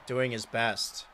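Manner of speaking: normal talking voice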